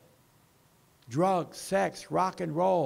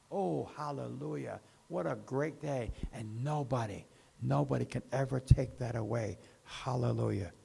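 hushed praise; 5 semitones lower, 4 dB quieter than usual. Real, not acted. Quiet rapture after altar talk.

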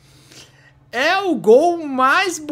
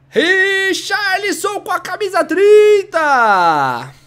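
slow stadium announcer call; 8 semitones higher, 6 dB louder, much slower than usual. Pretend. Announcing scorer like commentator.

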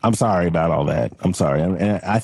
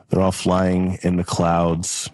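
ordinary delivery

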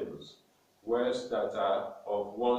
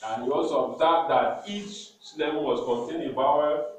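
ordinary talk, nothing marked

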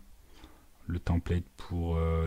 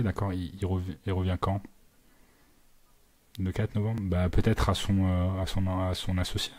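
polite, slightly raised phone voice; phone sales voice. Real, not acted. Cold-calling a dental office to reach the doctor, polite sales voice. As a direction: pitch yourself politely to the receptionist.

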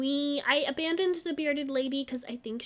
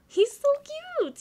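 high, louder gushing squeal; cute squeal; 14 semitones higher, 8 dB louder than usual. Real, not acted. Cooing over cute Sims child.

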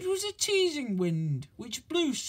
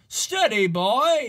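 loud, higher, firm; driver calling steady; 5 semitones higher, 7 dB louder, slower than usual. A narrator's reading. Gordon's driver calling to Gordon to slow down, loud. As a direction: rein him in like a horse. Driver calming speeding engine.

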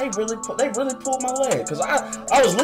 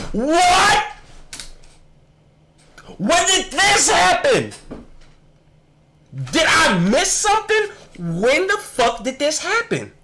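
high-pitched disbelieving outburst; stunned, high-pitched questioning; incredulous reiteration; 10 semitones higher, slower than usual. Real, not acted. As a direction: restate it high and drawn out, baffled. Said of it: "let me go ahead and reiterate what I said".

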